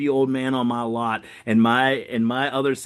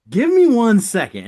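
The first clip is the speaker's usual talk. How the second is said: bright cheery stall; 10 semitones higher, 8 dB louder than usual. Retold or imitated. Excusing self from customer.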